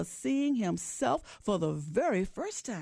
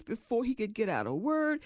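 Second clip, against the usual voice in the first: ordinary delivery, nothing marked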